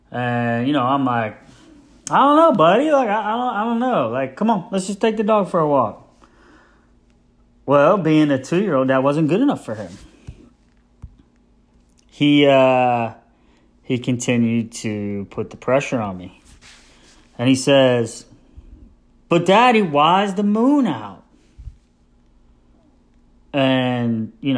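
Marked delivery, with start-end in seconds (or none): 2.1-6.1 flustered dad. Retold or imitated. Dad dodging question. 19.3-21.2 put-on insistent child. Son insisting.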